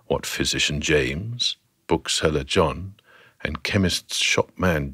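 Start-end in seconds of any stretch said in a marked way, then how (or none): none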